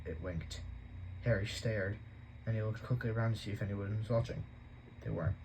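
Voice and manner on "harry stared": plain reading voice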